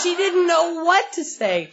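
high, slow, delighted quote; gleeful retelling. Retold or imitated. Sponsee gloating about the silenced coworker, high and delighted.

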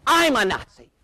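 high, loud indignant cry; imitated screaming wife. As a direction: echo the insult in outrage. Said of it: "She's screaming now".

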